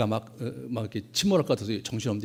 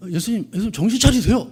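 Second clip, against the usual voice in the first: loud, higher urgent call; 8 semitones higher, 8 dB louder than usual. Retold or imitated. Disciple shouting to wake Jesus, loud urgent call.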